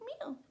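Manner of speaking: high squeaky mew; high soft kitten mew; kitten meow. Retold or imitated.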